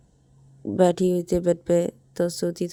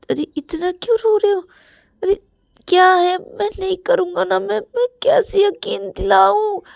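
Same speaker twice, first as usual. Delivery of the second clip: frantic pleading; 12 semitones higher, 7 dB louder than usual. Acted. Rahul frantically consoling crying Ravi.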